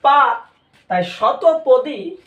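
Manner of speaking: loud, high পা, then a slow তাই শতপদী; emphatic teaching. Shown as a demonstration. Teacher hammering the key word for the students. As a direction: punch পা hard, then draw out শতপদী. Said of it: "পদী মানে হচ্ছে".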